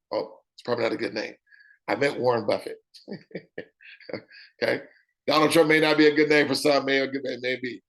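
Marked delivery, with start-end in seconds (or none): 3.1-4.8 laughing okay. Real, not acted. Laughs at his own name joke.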